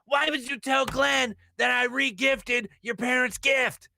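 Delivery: imitating a raging cohost; angry shout. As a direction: storm in shouting the accusation. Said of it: "comes fee-fi-fo-fumming into my studio".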